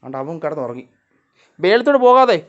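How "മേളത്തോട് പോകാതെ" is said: very loud, high call; shouted warning. Real, not acted. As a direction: stop the pet with a sharp shout. Warning a straying cat.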